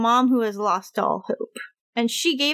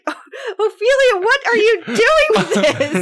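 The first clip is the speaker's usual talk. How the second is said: dismayed mother; 8 semitones higher, 5 dB louder than usual. Retold or imitated. Mother scolding her daughter over the mandrake, mock tearful dismay. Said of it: "and she's like".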